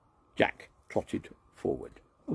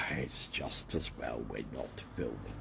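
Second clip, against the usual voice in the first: weary sigh; 9 semitones lower, 10 dB quieter than usual. A narrator's reading. Sigh after cat fails.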